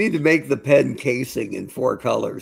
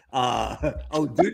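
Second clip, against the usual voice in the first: ordinary delivery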